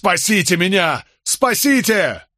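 high, desperate cry, last word stretched; yelling for help. A narrator's reading. Condemned man spotting his lawyer in the crowd, yelling for help. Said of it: "заорал во всю глотку".

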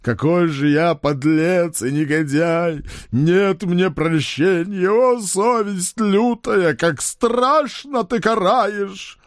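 loud, slow theatrical sobbing; sobbing wail. Acted. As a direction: wail in hammy despair. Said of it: "громко рыдал".

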